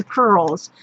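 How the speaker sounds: usual speaking voice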